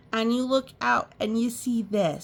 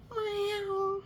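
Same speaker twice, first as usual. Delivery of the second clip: high meow; a high cat's meow; 13 semitones higher, 5 dB quieter than usual. Acted. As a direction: mew it like a cat. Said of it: "said Cat".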